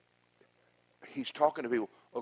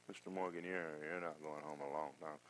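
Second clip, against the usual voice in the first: quiet doctor; 6 semitones lower, 14 dB quieter than usual. Retold or imitated.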